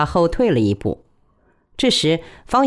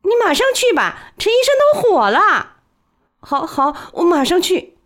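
hurried; 14 semitones higher than usual. A narrator's reading. Hurried exchange at the door.

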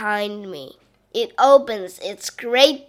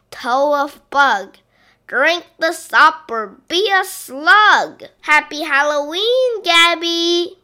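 loud, higher witch's spell ending; witch cackling spell; 6 semitones higher, 6 dB louder than usual. Acted. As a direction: build to a gleeful witch's cry. Witch finishes her spell loudly.

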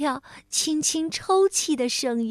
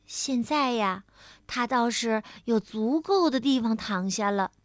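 ordinary delivery, nothing marked

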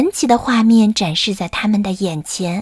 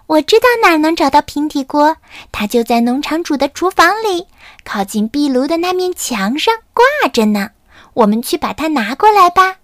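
put-on higher, eager young voice; a lightly voiced keen little chick; 6 semitones higher than usual. Carmen eagerly tells where the pan hangs.

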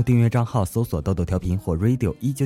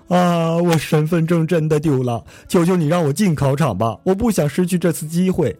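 pleading; 6 semitones higher than usual. Acted. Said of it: "苦苦求饶".

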